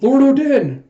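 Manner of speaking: loud, high, drawn-out call; crying out. Retold or imitated. Vassals call to their suffering lord, crying out. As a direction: cry out the lord's name in alarm. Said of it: "you have them crying out".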